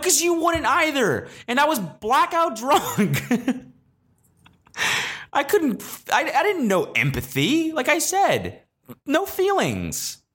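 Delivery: very high, squealing comic self-defence; defensive protest. Acted. Storyteller defending himself to critics, high squealed protest. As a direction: protest shrilly through a bit of laughter.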